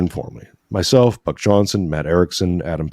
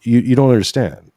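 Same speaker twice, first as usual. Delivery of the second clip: emphatic protest; 5 semitones higher, 4 dB louder than usual. Retold or imitated. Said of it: "I'm like".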